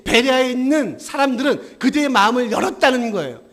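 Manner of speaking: emphatic, driving pulpit proclamation; full-force preaching. Acted. Preacher proclaims Bereans opened their hearts, full-force preaching. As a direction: hammer the point home to the congregation.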